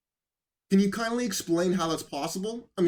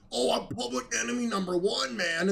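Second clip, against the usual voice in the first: mock high school bully; 5 semitones higher than usual. Host mocking a talk show host, mock high school bully. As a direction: imitate a self-important bully. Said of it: "talking like a high school bully".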